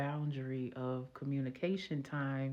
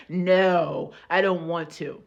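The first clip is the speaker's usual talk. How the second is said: loud, stubborn no; loud refusal; 10 dB louder, slower than usual. Retold or imitated. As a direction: refuse him outright, raising the voice. Refusing him while crying.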